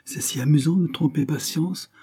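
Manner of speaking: high-pitched, airy flippancy; light words. A narrator's reading. Anna makes light of fooling Patience, flippant. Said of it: "ses paroles légères".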